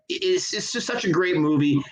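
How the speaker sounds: ordinary delivery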